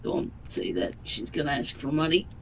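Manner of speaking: very quiet, low warning; hushed aside. Acted. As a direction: mutter it low under your breath.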